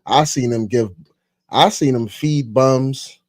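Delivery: usual speaking voice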